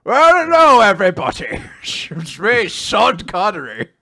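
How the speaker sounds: put-on loose Scottish accent, slow ending; a hint of a Scottish brogue; Sean Connery. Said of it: "only one Scottish accent I can do".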